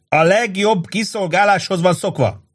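rowdy bragging shout. A narrator's reading. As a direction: keep yelling after the waiter. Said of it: "kiabált utána Angelo".

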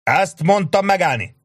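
high, loud stop command; furious halt order. A narrator's reading. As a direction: rein the men in hard. Sharp halting his men.

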